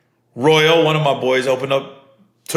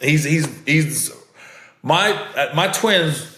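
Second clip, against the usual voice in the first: usual speaking voice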